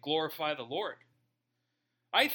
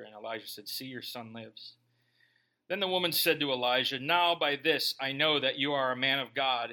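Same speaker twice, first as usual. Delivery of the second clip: measured, lower Bible-reading voice; scripture reading; 5 semitones lower than usual. A narrator's reading. Preacher reading scripture aloud to church, measured. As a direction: read the passage steadily to the congregation.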